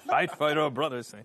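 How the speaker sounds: loud, high Scottish battle cry; rally the troops in Scottish accent; William Wallace. Retold or imitated. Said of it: "William Wallacey kind of verse there".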